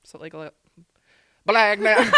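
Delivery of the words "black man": laughing. Real, not acted. Laughing mid-sentence at own slip.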